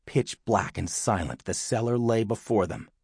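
ordinary delivery, nothing marked

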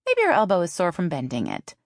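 sarcastic. A narrator's reading. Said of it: "her sister's sarcasm".

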